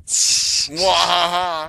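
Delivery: slow, drawn-out villain laugh; evil laugh. Pretend. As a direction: cackle like a cartoon villain. Evil laugh after fic joke.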